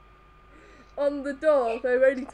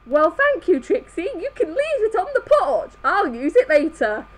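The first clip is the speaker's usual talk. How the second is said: polite Twilight voice; 6 semitones higher, 5 dB louder than usual. Acted.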